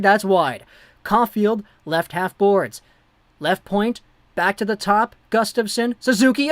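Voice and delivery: quick, loud hockey play-by-play; live play-by-play. Acted. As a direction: call the puck movement as it happens.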